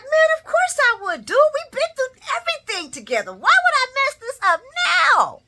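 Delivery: Brick. Retold or imitated. Vlogger mimicking Brick defending himself to his boss, high-pitched. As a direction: plead innocence way up high. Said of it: "Voice still high".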